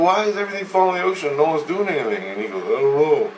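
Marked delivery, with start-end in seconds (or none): 0.0-2.2 high-pitched, incredulous retold question; puzzled protest, pitched well up; bewildered questioning. Dreamer questions the crumbling coast. 2.6-3.3 higher, mumbled clueless reply; mock dopey shrug of a voice; not-too-bright guy. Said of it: "He ain't too bright, this guy".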